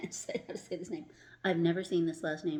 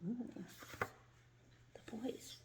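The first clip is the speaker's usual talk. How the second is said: hushed aside; 5 semitones higher, 12 dB quieter, faster than usual. Real, not acted. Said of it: "reader's aside".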